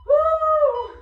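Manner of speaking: loud high whoop of effort; excited whoop. Real, not acted. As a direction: let out a big cheering woo. Coach cheers mid-exercise.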